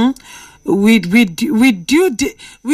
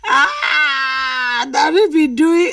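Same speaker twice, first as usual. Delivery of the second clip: laughing outcry; 5 semitones higher, much slower than usual. Real, not acted. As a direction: shriek with amused disbelief. Reacts to caller's prayer advice.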